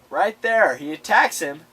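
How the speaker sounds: ordinary talk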